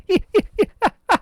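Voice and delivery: high burst of real laughter. Real, not acted. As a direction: laugh at yourself. Laughing at own voice.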